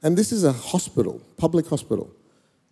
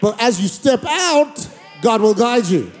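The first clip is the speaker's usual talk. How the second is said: rising, louder preacher cadence over music; preaching; 8 semitones higher, 3 dB louder, slower than usual. Acted. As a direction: preach it with building conviction.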